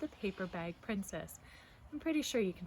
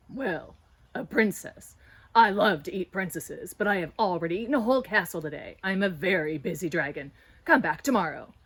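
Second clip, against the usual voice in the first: bored dragon; 3 semitones lower, 9 dB louder than usual. Acted. Dragon turning the princess away, loud and dismissive. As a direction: boom it out like a busy, bored dragon.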